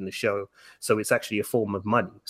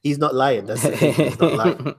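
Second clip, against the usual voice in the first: real laughing voice, loud and higher; amused, raised-voice backing-up; 5 semitones higher, 6 dB louder than usual. Real, not acted. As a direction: vouch for a friend through loud laughter. Vouching for friend amid shared laughter.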